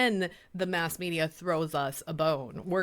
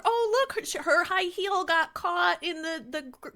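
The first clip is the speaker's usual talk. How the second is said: mock damsel; 7 semitones higher than usual. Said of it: "Or it has to be clever".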